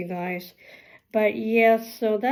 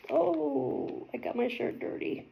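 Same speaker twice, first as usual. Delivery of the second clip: whispered to herself; under her breath; muttered aside; 3 semitones higher, 9 dB quieter than usual. Real, not acted. Muttering to self about dirty shirt.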